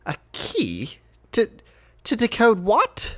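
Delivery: lightly voiced puzzled lord, climbing on the last question; Lord Scheinheimer, baffled. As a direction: question it in halting bewilderment.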